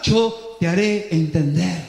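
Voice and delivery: reply calmly as God. Acted. Pero dice Dios.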